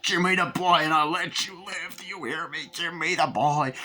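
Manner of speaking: high, strained menacing doll voice; Chucky's furious demand. Retold or imitated. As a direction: demand it through a stabbed door. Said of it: "then Chucky stabs the fucking door".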